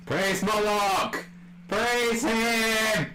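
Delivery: slow, loud, high chant; shouted cheer. Pretend. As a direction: hail him like a cult chant. Cheering the song loudly.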